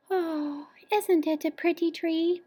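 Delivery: high, soft, sleepy mama bear; drowsy yawning. A narrator's reading. Mama bear admires the present, sleepily. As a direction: yawn it out drowsily. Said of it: "yawned Mama Bear quite drowsily".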